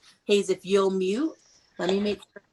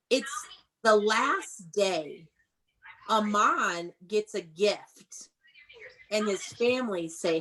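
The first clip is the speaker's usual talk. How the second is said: slow story reading. Shown as a demonstration. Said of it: "teacher reading story slowly".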